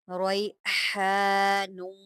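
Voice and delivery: रैहानुन slowly with the ha clearly breathed. Shown as a demonstration. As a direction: bring out the ha in रैहानुन. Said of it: "यहाँ पर भी आप हा निकालेंगे".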